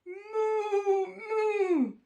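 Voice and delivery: high, loud drawn-out mooing; cow's moo. A narrator's reading. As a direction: moo twice, long and high. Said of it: "the duck on the pond said".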